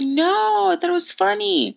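very high put-on voice; custodian voice. Custodian reassuring an apologetic student, high imitation. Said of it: "He was like".

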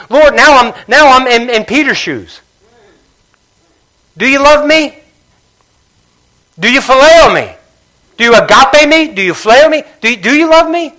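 pleading intensity. Acted. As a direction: press each question harder. Jesus questioning Peter, imagined intensely.

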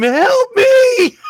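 very high, loud comic shriek for help; mock scream. Lab mouse screams while its brain is watched, hammed-up shriek. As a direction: squeal it like a terrified little mouse. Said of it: "while they scream".

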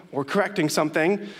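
normal talking voice

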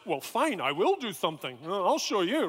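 mock huffy acceptance of a challenge; grudging, show-you retort; arrogant defiance. Arrogant student taking up tutor's challenge, defiant. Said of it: "the other part of my arrogance went".

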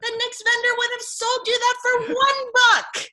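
laughing mock shout of maternal outrage; scolding mom. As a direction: imitate a mother scolding, high and loud. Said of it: "my mom scolded and scolded me".

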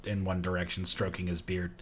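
normal talking voice

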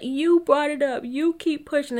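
put-on upset voice, high and pushy; mock crying accusation. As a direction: whine it like you're mimicking someone in tears. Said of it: "she starts crying".

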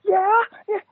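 very high, squeaky nervous yeah; tiny high-pitched jittery agreement; nervous squeak. Retold or imitated. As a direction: imitate your own starstruck, nervous voice. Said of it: "I was so nervous".

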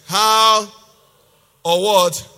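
"How" and "what" each very loud and separate; exaggerated emphasis. Shown as a demonstration. Preacher questioning what people emphasize, "How" and "what" hit loudly. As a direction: punch "How", pause, then punch "what". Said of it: "is that what you hear people emphasize".

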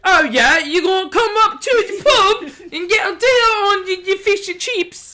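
loud, high mock-British pub yell; botched British accent, veering into Kiwi, hammed up loud; horrible British accent. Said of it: "that was a horrible accent".

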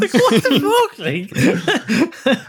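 high laughing outburst. Real, not acted. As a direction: laugh it out in disbelief. Laughing disbelief.

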